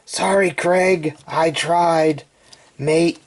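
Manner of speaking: mock-Australian apology, slow at first; Australian accent. Reviewer apologizing to his buddy in Australia, mock Australian accent. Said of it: "Australian accent".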